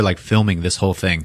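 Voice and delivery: normal talking voice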